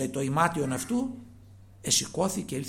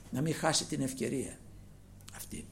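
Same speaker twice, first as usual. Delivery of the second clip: ordinary talk, nothing marked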